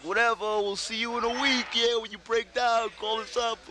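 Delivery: high, sing-song teasing from doubtful friends; mocking doubt. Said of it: "all our friends were like".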